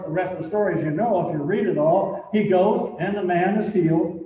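ordinary talk

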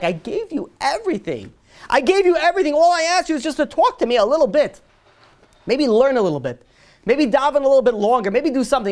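loud, high pleading voice rising; pleading God. Acted. God begging the sleepy guest, loud and high. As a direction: plead like a hurt host who gave all.

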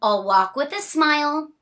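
bright, sing-song picture-book reading; reading to children. A narrator's reading. Reading a picture book to a child, sing-song. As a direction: read the rhyme cheerfully to a small child.

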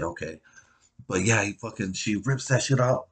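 ordinary delivery

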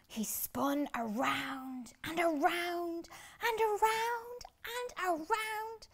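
a giddy slow climb upward; spinning. A narrator's reading. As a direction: draw out each around as it spins. Narrating spinning hat.

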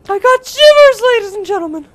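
very high, loud falsetto squeal; squealed shivers. Pretend. Player tells viewers he got shivers, high squeal. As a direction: squeal it out with the creeps.

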